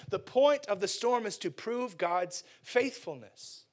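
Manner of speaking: usual speaking voice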